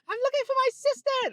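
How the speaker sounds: put-on very high, squeaky British accent; hammed-up high-pitched British voice; British girl's accent. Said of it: "That's exactly how she sounded. So don't mock the accent".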